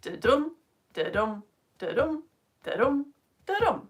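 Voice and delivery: "Didum" five times, each stressed on DUM; steady di-DUM beat with gaps; five iambs. Shown as a demonstration. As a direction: tap out "didum" as evenly spaced heartbeats. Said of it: "So it's five lots of those".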